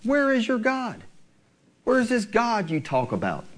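mocking, sneering question; a hint of a mocking taunt. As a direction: jeer at him like a scoffer. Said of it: "the devil is taunting him through others".

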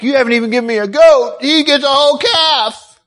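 aggrieved sibling outburst; indignant brother. Acted. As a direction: protest the unfairness, pitch climbing to the end. Acting out the indignant older brother.

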